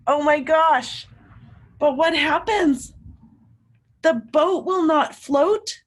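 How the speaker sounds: loud, high storytime dismay; excited gasp. Acted. Teacher reading to kids, excited disbelief. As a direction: wow the kids with the bad news.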